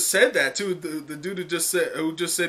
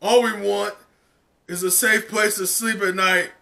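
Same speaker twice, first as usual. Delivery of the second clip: louder, higher protest cry; protesters demanding; 5 semitones higher, 5 dB louder than usual. Acted. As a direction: call out the demand. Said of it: "the protesters go".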